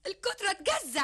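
high voice cracking into a sob; wife breaking down, tearful; breaking into tears. Acted. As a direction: let the line crumble into crying.

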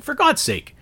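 high, exasperated groan of a line. Retold or imitated. Fed-up customer exclaiming when the repairman goes silent again. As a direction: throw up your hands in disbelief. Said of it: "I'm like".

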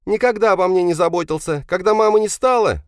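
very high, loud upset voice; hurt reproach. Acted. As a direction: reproach father, close to tears. Tearful son reproaches father. (обиженный упрёк высоким голосом)

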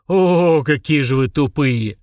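loud, drawn-out exasperated groan; exasperated eye roll. A narrator's reading. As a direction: sigh at how stupid they are. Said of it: "закатил глаза Фурундарок".

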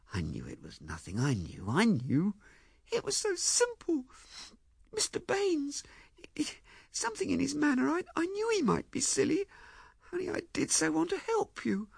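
high, tearful, faltering confession; wailing. Acted. Ethel tearfully explains about Mister Baines, wailing. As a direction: stumble through it in a very high, plaintive voice. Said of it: "she wailed".

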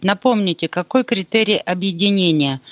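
usual speaking voice

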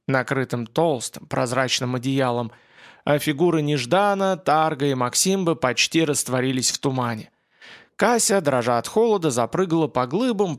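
plain reading voice

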